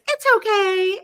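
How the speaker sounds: high, bright fake comfort; mock chirpy reassurance. Someone brushing off her pain with it's okay. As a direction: sing out a hollow it's okay. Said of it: "someone says".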